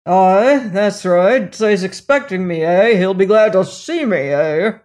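loud, gruff, mocking Robert; rough colonial. A narrator's reading. Robert mocking his brother to the maid, loud and gruff. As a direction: bark it out with coarse scorn.

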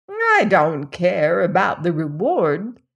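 put-on lightly croaked old woman offering help; old woman, sweetly. A narrator's reading. Old woman's greedy reply.

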